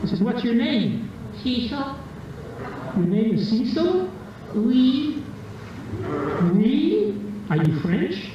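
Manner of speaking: high cheeky French puppet voice; a sassy little dummy answering back; ventriloquist dummy. Acted. Ventriloquist banters with his French dummy Cecil, high puppet voice.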